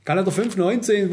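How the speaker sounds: ordinary talk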